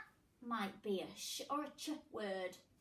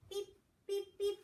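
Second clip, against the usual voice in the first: machine beeps; 8 semitones higher than usual. Pretend.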